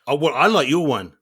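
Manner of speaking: normal talking voice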